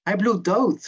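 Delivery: high, loud panicked cry; screamed. A narrator's reading. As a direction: shriek it in alarm as the character. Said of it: "gilde Maxime".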